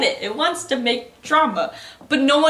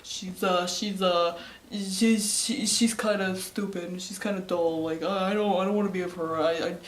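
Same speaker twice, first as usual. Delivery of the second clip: dull guy voice; 3 semitones lower, 4 dB quieter than usual. Retold or imitated. Makoto whining about his girlfriend, low dopey mumble. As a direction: drone it out like a bored dimwit.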